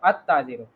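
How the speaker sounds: अत्ताजिरु with a doubled त and a low, falling close; Arabic pronunciation. Shown as a demonstration. Teacher repeats the right form to the student. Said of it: "अल ताजिरु नहीं".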